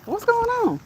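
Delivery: high, puzzled ask; puzzled question. Retold or imitated. Older cousin asks the couple what happened, high and puzzled. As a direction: sound clueless about the fuss.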